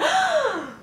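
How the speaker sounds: sharp, high mock gasp; acted gasp of shock; crowd gasp. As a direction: gasp like a stunned onlooker. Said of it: "and they gasp".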